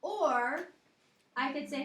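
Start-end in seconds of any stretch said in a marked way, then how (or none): none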